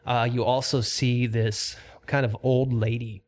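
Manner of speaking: steady, measured scene-setting; lightly dramatic dungeon description; game master narration. Acted. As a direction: paint the chamber for the players. GM sets the scene.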